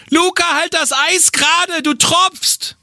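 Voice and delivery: high, nagging parent voice; scolding mother. Acted. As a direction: nag a child in a high voice. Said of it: "stellen wir mal so eine typische Geräuschkulisse nach".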